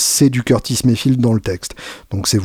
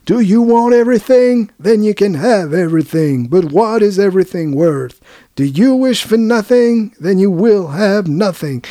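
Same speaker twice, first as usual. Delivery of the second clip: gospel preacher; 9 semitones higher than usual. Retold or imitated. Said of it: "à la manière donc d'un prêcheur gospel".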